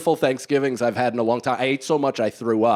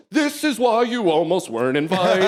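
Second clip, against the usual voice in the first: scolding dad voice; 6 semitones higher than usual. Retold or imitated. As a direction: snap at him like a parent warning a kid. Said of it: "I just broke out into a dad".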